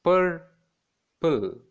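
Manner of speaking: "purple" as pur, long pause, pull; syllable by syllable. Shown as a demonstration. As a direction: sound out each syllable of "purple". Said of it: "has two syllables, that is".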